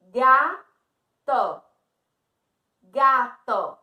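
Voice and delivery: GATO said loud as GA, TO with a gap; split into syllables. Shown as a demonstration. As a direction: punch out each syllable of GATO, GA then TO. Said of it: "colocou o dedinho".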